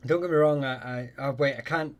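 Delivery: ordinary talk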